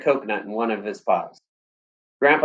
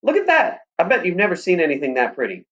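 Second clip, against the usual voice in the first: excited; 7 semitones higher than usual. A narrator's reading. Boy excited pointing at tree.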